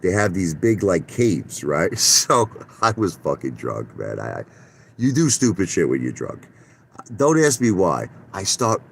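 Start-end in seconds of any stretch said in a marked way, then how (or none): none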